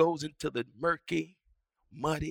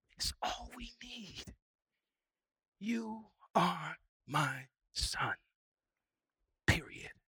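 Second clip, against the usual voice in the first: hushed tender voice of God. Acted. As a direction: breathe the words like a gentle promise. God's voice, soft and hushed.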